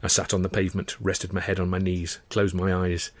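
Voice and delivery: ordinary delivery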